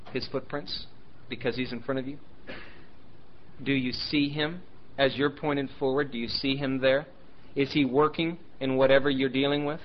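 normal talking voice

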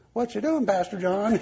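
put-on high, sing-song little boy; high sing-song child's question; sing-song kid voice. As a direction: chirp it up high like a small kid. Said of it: "he looks and goes".

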